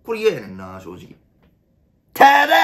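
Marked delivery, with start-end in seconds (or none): 2.1-2.6 catchphrase shout. Acted. Comedian yelling his catchphrase at the audience, long and high. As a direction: belt it out, stretched and high.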